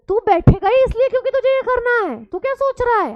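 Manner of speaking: high, loud playful teasing. Real, not acted. Teacher teasing Aman in front of the class.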